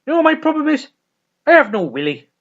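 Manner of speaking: loud, high, aggrieved retort; presenter's bitter answer. Retold or imitated. The presenter answering Dougal, loud and aggrieved. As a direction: spit out the complaint. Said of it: "And Dougal's like".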